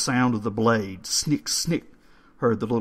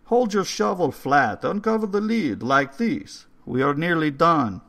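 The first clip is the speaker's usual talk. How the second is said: put-on higher and louder old caretaker; urgent old man; 8 semitones higher, 6 dB louder than usual. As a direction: warn them sharply as the old man. Old man directs the digging.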